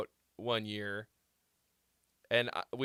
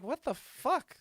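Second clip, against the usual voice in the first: much higher, incredulous yelp; shocked outburst; 13 semitones higher, slower than usual. Retold or imitated. Tasting bad chicken at Roscoe's, shocked yelp. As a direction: disbelief at a bad plate.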